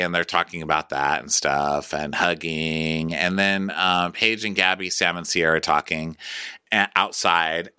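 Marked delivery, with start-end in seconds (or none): none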